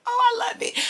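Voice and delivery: jumps high with delight; bright burst of joy; delighted gush. Real, not acted. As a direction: swept up in love for the story. Delighted by the scripture.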